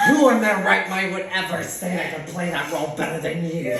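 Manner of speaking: loud, hammed-up indignant rant; crazed outrage. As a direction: imitate castmates wildly incensed on your behalf. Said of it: "Y'all are crazy right now".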